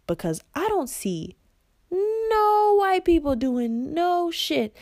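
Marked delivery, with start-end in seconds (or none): 1.9-4.8 high, slow and drawn out; indignant. Real, not acted. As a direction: stretch it in disbelief. Indignant exaggerated line.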